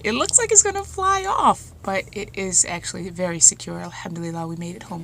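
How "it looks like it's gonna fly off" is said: worried daughter. Retold or imitated. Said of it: "And I'm like".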